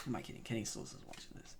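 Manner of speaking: muttered aside. Real, not acted. Fan admitting the player isn't watching, muttered aside. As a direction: drop to a low, near-voiceless self-mocking mutter.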